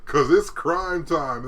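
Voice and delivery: slow, loud hype man chant. Retold or imitated. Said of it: "he said".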